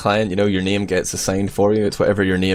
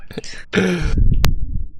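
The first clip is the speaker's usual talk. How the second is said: breathy laugh; 9 semitones higher than usual. Real, not acted. Cohost jokes about drunk impression.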